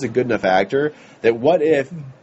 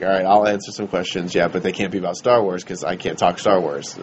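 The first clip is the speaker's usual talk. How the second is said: gruff Harrison impression; 8 semitones lower than usual. Voicing Harrison Ford on Kimmel.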